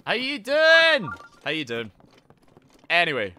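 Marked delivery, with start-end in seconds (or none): 0.0-1.1 high, loud, slow sing-song greeting; drawn-out greeting. Real, not acted. Streamer greeting a viewer, loud sing-song version. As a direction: draw it out like a game-show host.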